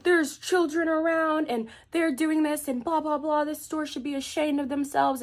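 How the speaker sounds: high-pitched imitation of a scandalized older lady; mock outraged rampage. Older lady scolding the store, imitated high outraged rant. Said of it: "going on this whole rampage".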